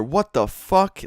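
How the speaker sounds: high, drawn-out stunned what the fuck; like finding your door smashed; shocked outburst. Retold or imitated. Dad finds shattered door.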